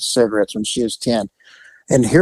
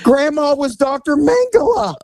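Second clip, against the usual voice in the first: shocked; 12 semitones higher than usual. Real, not acted. Shocked punchline.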